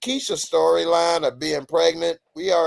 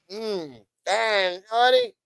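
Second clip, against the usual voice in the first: high-pitched appreciative come-on; mock admiring; 8 semitones higher, slower than usual. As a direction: play-act being wowed by someone attractive. Acting younger self admiring a woman.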